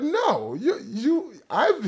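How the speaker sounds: lightly Jamaican, high-pitched no; Jamaican mother refusing. Retold or imitated. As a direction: playfully imitate mom refusing. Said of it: "the accent that I'm trying to replicate".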